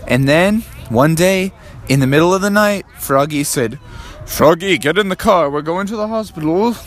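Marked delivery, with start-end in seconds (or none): 4.2-6.8 slightly raised, lightly urgent father; urgent dad. Acted. Dad hurries Froggy to the car, mildly urgent. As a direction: call the child to get moving.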